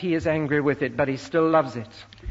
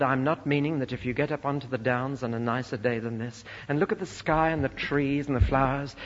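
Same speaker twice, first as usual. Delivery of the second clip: usual speaking voice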